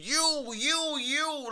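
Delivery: high, drawn-out mocking quote; mocking imitation. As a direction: mimic her with long high vowels. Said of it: "right here saying".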